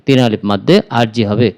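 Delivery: ordinary delivery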